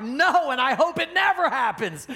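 loud, high-pitched comic protest; hammed-up indignant outburst; emphatic mock refusal. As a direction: blurt it out in mock horror. Acting a guest's alarmed refusal.

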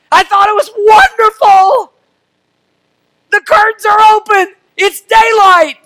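high, loud, joyful telling; excited kid. Retold or imitated. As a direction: relive a child's Christmas morning delight. Child's joy on Christmas morning.

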